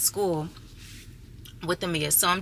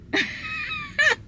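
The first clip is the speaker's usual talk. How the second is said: laugh-cry. Real, not acted. Crying in the middle of Walmart.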